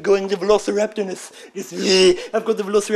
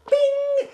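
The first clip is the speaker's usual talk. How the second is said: a very high sung bing like an elevator chime; ping it out like the lift arriving. Retold or imitated. Imitating the elevator.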